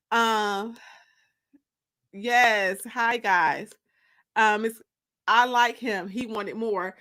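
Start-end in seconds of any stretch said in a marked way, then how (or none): none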